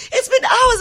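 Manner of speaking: high, loud retold shout; acted-out high-pitched yell; mock yelled complaint. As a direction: holler back in a re-enacted argument. Acting out a shouted family argument.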